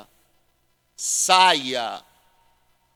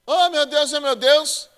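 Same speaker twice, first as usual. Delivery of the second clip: high, loud hammed-up wail of a mourner about to faint; mock wailing mourner; 11 semitones higher, 7 dB louder, slower than usual. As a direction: ham up a swooning mourner. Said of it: "eu fazia assim".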